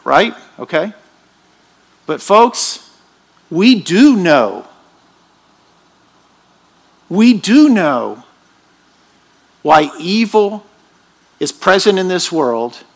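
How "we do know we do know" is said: we do know with a slow, heavy DO; preacher insisting, stressing do. Real, not acted. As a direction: lean hard on do, slowly. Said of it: "emphatic repeat".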